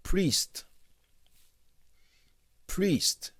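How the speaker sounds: Priest pitched high, crisp English pronunciation; careful English model. Shown as a demonstration. Teacher pronouncing Priest for Creole-speaking students, careful model. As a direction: model Priest clearly for learners. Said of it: "Pwononse l janm pwononse a".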